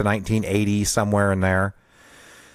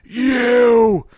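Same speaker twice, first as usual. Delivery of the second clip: high, loud yelled insult; angry shout; 13 semitones higher, 6 dB louder, much slower than usual. Retold or imitated. Dad yelling out the car window, furious shout. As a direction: bellow it out the car window at the kids. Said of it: "and he goes".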